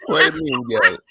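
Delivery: hammed-up Jamaican man pleading with a girl; Jamaican accent. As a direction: put on a thick Jamaican accent. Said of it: "He said it in his accent".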